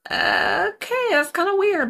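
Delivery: high, far-off puzzled voice; a high-pitched imitated inner thought. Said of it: "looking in the back of her mind like".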